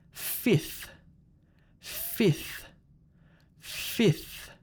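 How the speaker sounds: "Fifth" ending in f sliding into th; fth ending. Shown as a demonstration. Teacher models for repeating, "Fifth" with f plus th. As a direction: blow f into th to end "Fifth". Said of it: "It's the fth sound".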